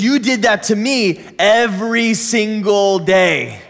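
slow, drawn-out retold reproach; emphatic accusation. Mom to her grown son about his childhood, slow emphatic reproach. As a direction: stretch out every word to drive the blame home.

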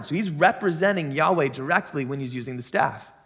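ordinary talk, nothing marked

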